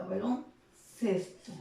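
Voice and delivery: slow "Baloncesto", split before the tonic CES; "Baloncesto" in slow teaching pieces; stressed syllable shown. Shown as a demonstration. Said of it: "va a tener la sílaba tónica".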